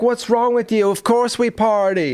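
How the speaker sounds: high-pitched mock of a tipsy woman; drunk Irish sister. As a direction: imitate her loose, high, carefree retort. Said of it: "who's drunk out of her mind at this point".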